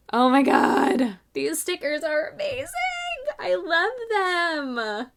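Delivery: loud, drawn-out gushing delight; freaking out. Real, not acted. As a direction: burst out, stretching the words in real excitement. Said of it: "I'm, like, totally freaking out".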